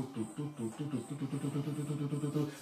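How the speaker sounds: soft rapid tu tu tu heartbeat; imitated heartbeat. Telling how his racing heart sounded, soft quick heartbeat. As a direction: tap out a racing heart quietly. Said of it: "eu escutava ele assim".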